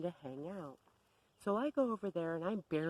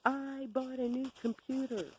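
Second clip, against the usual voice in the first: imitated screaming; 7 semitones higher than usual. Bev charging up to announce her new computer, higher mock yell. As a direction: announce it high and triumphant. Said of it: "charge up into my face screaming".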